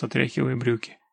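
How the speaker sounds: ordinary delivery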